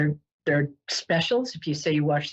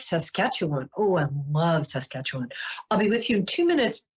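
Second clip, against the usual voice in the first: ordinary delivery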